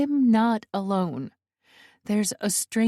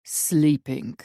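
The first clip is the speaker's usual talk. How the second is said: put-on low Russian-accented intruder; unruffled Russian villain, pitched down; 5 semitones lower than usual. A narrator's reading. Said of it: "The Russian accent".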